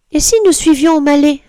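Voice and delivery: high kitten voice suggesting; lightly voiced girl kitten; little kitten. A narrator's reading. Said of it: "propose Marie".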